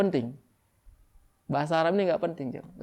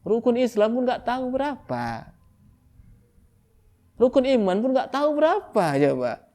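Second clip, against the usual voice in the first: incredulous, building outrage; rising exasperation; 8 semitones higher, 4 dB louder than usual. Real, not acted. Preacher scolding ignorance, climbing in disbelief. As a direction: voice climbs high and loud in disbelief.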